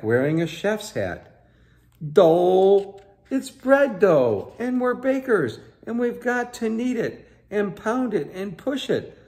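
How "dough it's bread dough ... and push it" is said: much higher, eager playful voice starting loud; excited baker game. Acted. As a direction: bubble over with make-believe. Fifi pretends to be a baker.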